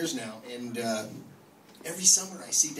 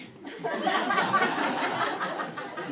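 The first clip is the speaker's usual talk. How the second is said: high laugh. Real, not acted. Own laugh at daughter's line.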